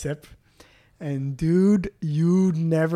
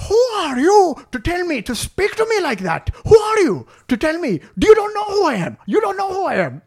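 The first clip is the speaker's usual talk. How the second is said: put-on very high, indignant Indian-accented rant; hammed-up high-pitched outrage; mock raised voice; 13 semitones higher, 4 dB louder than usual. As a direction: imitate an outraged big shot pulling rank. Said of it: "raising his voice a lot".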